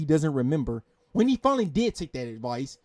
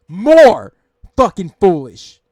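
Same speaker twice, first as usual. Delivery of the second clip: much louder, furious repeat, slow at the end; angry shout; 14 dB louder, much slower than usual. Real, not acted. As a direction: blow up at the streamer. Angry rant at streamer.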